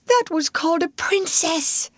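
high, crying lament; breaking into tears. A narrator's reading. Maid weeping over Sarah's fate, high and tearful. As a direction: let the tears break through. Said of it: "breaking out afresh".

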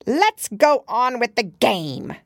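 high, slow regal command; imperious Queen. A narrator's reading. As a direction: bark it like a haughty queen. Queen voiced in story.

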